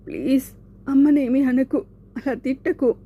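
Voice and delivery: desperate begging for his mother. Acted. Shanku begging, mother insulted. (అమ్మను తిట్టొద్దని ఆర్తిగా)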